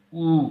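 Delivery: ऊ as a loud, held long oo; teacher sounding out ऊ; pronunciation demo. Shown as a demonstration. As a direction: hold the vowel ऊ out clearly. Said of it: "उच्चारण किया जाता है".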